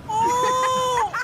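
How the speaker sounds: sharp high loud oh; startled shout. Real, not acted. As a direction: yelp at the sudden crash. Startled by fail clip.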